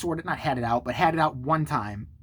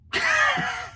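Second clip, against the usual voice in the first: high burst of laughter; amused laugh. Real, not acted. Laughing after retelling own retort, high amused laugh. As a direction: crack up, high and sudden.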